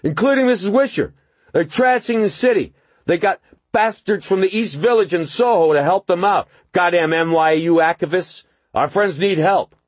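high, loud slurring rant; slurred drunk voice. A narrator's reading. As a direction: slur the anger out. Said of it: "the slurred voice".

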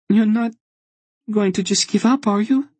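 put-on a little girl pleading with a reproach; accusing. A narrator's reading. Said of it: "She said it almost accusingly".

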